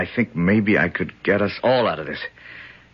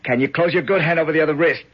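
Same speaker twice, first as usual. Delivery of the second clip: straining effort; 13 semitones higher than usual. Acted. Climbing onto shoulders, straining.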